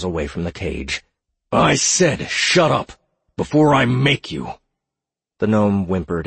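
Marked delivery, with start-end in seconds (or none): 1.5-4.5 slow, louder threatening voice; menacing threat. A narrator's reading. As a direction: threaten her coldly and slowly. Edward threatening prisoner.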